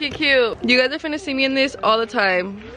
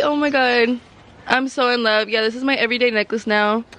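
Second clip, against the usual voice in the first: ordinary delivery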